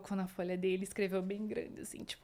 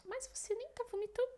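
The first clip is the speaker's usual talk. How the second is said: high, softer incredulous question; mock worried surprise; 12 semitones higher, 9 dB quieter than usual. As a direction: imitate the anxious husband. Husband worried in the car.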